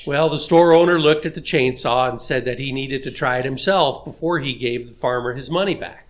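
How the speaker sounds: ordinary talk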